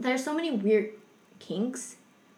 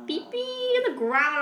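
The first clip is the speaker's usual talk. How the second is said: squeaky disbelief; 11 semitones higher, slower than usual. Retold or imitated. Retold disgust, squeaky childish word.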